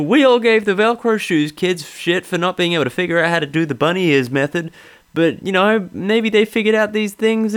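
mock a smug observational stand-up; lightly parodied sitcom comic; Jerry Seinfeld impression. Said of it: "taking the piss out of his terrible riffs".

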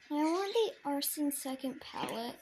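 usual speaking voice

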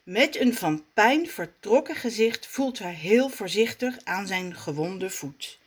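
ordinary delivery, nothing marked

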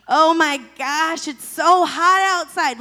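loud, slow, drawn-out moaning; exaggerated everyday whine about the weather; mock complaint. Shown as a demonstration. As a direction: groan about the heat like it's unbearable. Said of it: "Like, that's a complaint".

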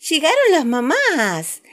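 high, loud, slow calling out; excited shout. A narrator's reading. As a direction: announce it with joy. Children calling out.

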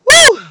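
sudden high loud whoop; high whoop of praise. Real, not acted. Preacher stirred by the blood of the Lamb, whooping. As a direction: let out a cry of praise.

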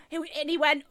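ordinary talk

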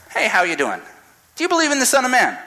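Jesus greeting a stranger casually; breezy greeting. Retold or imitated. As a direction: drop in cheerily on a stranger. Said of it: "Jesus shows up and says".

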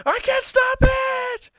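very high, loud, slow cry; despairing high shout. Retold or imitated. Goku as the planet blows, a slow, high despairing shout. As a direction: wail it as all hope goes.

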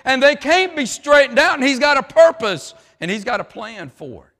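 loud, high, driving sermon voice; full-force preaching. Acted. Preacher rousing the congregation, loud and driving. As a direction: preach it out over the crowd.

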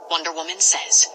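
usual speaking voice

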